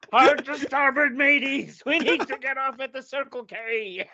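high, loud, slow pirate bellow; hammed-up sea captain steering into port. Pirate captain joke on sails.

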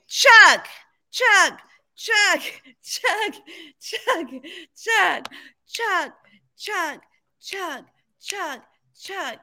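loud, high chanting of chug; party-chant chug at a friend; frat bro. Pretend. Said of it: "Let's count like I'm in college".

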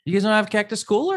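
high, loud disbelief at Cactus Cooler; can't believe they've never had it; stunned the others have never heard of it, loud and high; incredulous. Real, not acted. Shocked friends don't know it.

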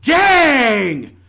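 Dang yelled out loud and high; shouted Dang. Pretend. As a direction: bellow Dang in mock despair. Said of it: "I will now do a dramatic monologue".

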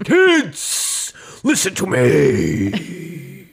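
put-on a leap up on Kids, then very slow; hammed-up call for attention; performative teacher. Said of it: "I will be very performative".

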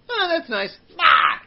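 high, loud mocking cry; mock shriek. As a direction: squeal it out and toss it. Acted director tossing it away.

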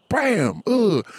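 high sound-effect bam then a grunt; mock bam and grunt. Toddler shoving plate off table, high mock crash grunt. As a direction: punch out a cartoon crash.

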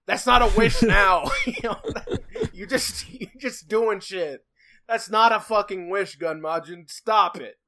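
loud, laughing rant; exasperated scolding. Real, not acted. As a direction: tell him off with disbelief. Mock scolding the genie.

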